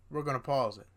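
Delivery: ordinary talk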